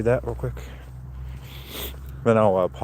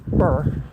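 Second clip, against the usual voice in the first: real shiver sound from the wind; quick cold brr through the lips; 5 semitones higher than usual. Real, not acted. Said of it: "that wind's howling".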